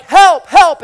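loud, high-pitched panicked cries; hammed-up yell for help; mock scream for help. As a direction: shout out like a man running for his life. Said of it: "he hears the blood-curdling scream".

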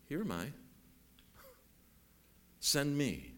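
usual speaking voice

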